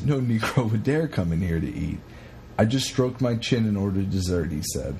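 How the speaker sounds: ordinary talk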